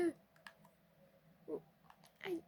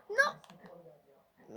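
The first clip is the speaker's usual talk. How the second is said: dismayed cry; 10 semitones higher than usual. Real, not acted. Shot saved by keeper.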